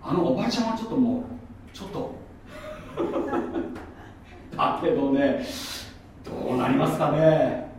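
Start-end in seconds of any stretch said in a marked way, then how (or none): 2.2-4.5 soft breath-filled chuckle; laugh. Real, not acted. Joking about that lady politician, soft chuckle. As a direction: break into a quiet laugh.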